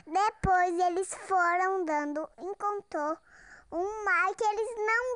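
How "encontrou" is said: ordinary delivery, nothing marked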